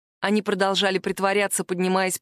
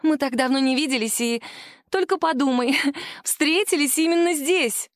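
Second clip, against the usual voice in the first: cartoon princess; 6 semitones higher than usual. Angela gushes, laughs.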